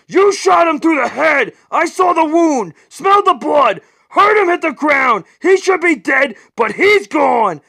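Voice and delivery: high, loud furious scream; screaming in anger. Acted. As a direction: scream it, furious and past caring. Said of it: "screams at the Shadow".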